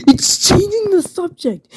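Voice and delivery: very high, strained squeak; comic squeaky aside. Acted.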